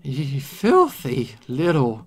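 slow, strained insult; choking curse. Acted. Poisoned victim to poisoner, choking anger. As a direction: drag the words out while choking.

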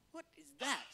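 high, baffled question; startled what. Retold or imitated. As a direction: squeak it out in surprise. Moses startled by the bush.

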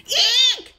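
loud, high eek scream. A narrator's reading. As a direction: scream at waking up. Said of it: "screamed Goldilocks".

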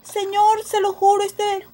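high, loud frightened plea; pleading. Acted. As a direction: swear to the officer it isn't hers. Sophie suplica al policía. (Sophie asustada suplica, aguda)

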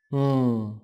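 a held nasal "Ng" with no vowel after it; NG sound. Shown as a demonstration. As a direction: hum "Ng" without releasing an ờ. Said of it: "đọc giống từ ng trong tiếng Việt". (âm "Ng" không bật ra âm ờ)

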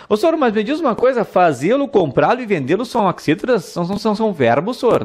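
quick high student voice; student asking. Acted. A student asks the teacher, quick and high-pitched. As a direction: play the eager student, fast and high.

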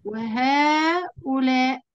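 وهؤلاء said slowly, long aa after the ha; drawn-out demo word. Shown as a demonstration. Teacher listing demonstratives for students, slow. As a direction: stretch وهؤلاء to show the alif. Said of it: "وإيه كمان؟".

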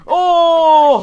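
loud, high startled yell; scared. Real, not acted. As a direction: cry out in real fright. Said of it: "scared the shit out of me".

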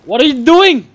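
high, loud panicked yell; alarmed shout. Real, not acted. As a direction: shriek at your reckless partner. Partner panics at sudden charge.

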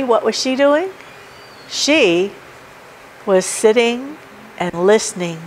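ordinary talk

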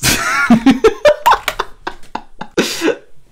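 high-pitched wheezing laugh; helpless laughter. Real, not acted. Streamer hearing the police station twist, wheezing laughter. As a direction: crack up in a long, squeaky wheeze.

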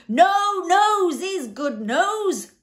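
high, huge, slow hysterical roar; thundering. A narrator's reading. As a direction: thunder it out in drawn-out high notes. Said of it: "thundered the Din once again".

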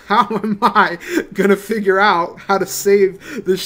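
real helpless laugh through the words; slow, laughing in disbelief at the question. Real, not acted. Son overwhelmed by his family's sinking ship, laughing.

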